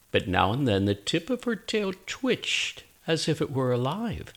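soft, suspenseful storytelling hush. A narrator's reading. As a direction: tiptoe the words past the cat. Tense moment near the cat.

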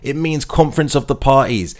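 normal talking voice

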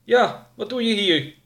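high-pitched younger sidekick echo; high, insistent echo; younger man. Acted. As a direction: parrot the boss's challenge in a high voice. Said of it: "herhaalde de jongere".

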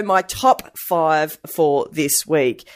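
ordinary talk, nothing marked

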